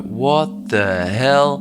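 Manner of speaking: slow, drawn-out what the hell; seeing the pyramid, slow stunned surprise; stunned disbelief. Retold or imitated. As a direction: let it dawn on you slowly. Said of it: "I sort of look up and go".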